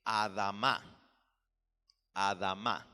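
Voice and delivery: "Adamá" as a Hebrew word, ending stressed; Hebrew pronunciation. Shown as a demonstration. Teacher giving listeners the Hebrew word for earth, careful Hebrew pronunciation of "Adamá". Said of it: "tierra se dice".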